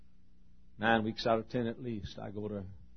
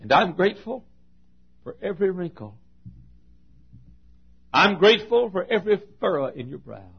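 heartfelt thanks to mother; 7 semitones higher, 12 dB louder than usual. Real, not acted.

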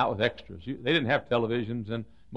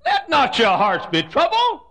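hammed-up Jesus line; 12 dB louder, slower than usual. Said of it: "And I think Jesus said".

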